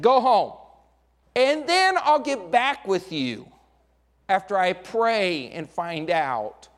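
high, loud and wavering, stalling for time; mocking hesitant tone. Preacher mocking Balaam's hedged reply to the princes. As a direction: mimic a prophet hedging his answer. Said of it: "if he would have finished the sentence right there".

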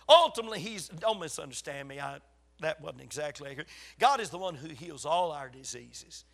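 ordinary delivery

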